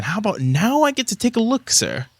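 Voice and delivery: high, pointedly polite elvish scholar; pointed request. Acted. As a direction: press the older man for permission, pitch lifted. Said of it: "he kind of gives a pointed look".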